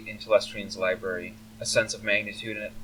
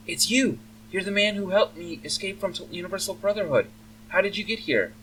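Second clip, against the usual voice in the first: put-on higher, startled elf girl voice; excited young elf; 11 semitones higher than usual. Elf daughter recognizes the man who rescued her, excited. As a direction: blurt it out high in happy recognition.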